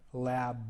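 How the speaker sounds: "lab" with a buzzing voiced b; "lab" ending on a clear voiced b. Shown as a demonstration. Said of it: "You can hear the voice at the end".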